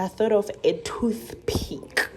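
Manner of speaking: breathy secret whisper; hushed aside to viewers. Real, not acted.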